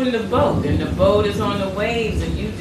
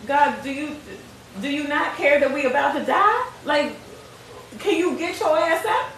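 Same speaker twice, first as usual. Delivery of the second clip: frantic disciple; 6 semitones higher than usual. Retold or imitated. Disciple wakes Jesus in storm.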